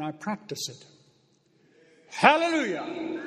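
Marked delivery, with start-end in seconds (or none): 2.1-3.2 drawn out, pitched high and loud; high loud slow hallelujah; exultant hallelujah. Real, not acted. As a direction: burst out in praise. Preacher exclaiming praise.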